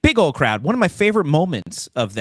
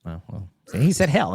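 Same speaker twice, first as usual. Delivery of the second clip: ordinary talk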